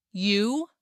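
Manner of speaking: a short, disbelieving scoff rising on the word. A narrator's reading. Boss to the cleaner who claims the contract, scoffing. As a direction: scoff at the very idea. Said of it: "he scoffed".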